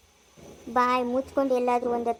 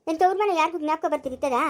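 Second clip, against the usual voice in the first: normal talking voice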